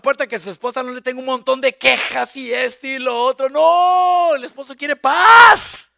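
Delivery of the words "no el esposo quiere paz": full-voiced preaching shout. Real, not acted. Preacher shouting at the husbands. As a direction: bellow it at the congregation.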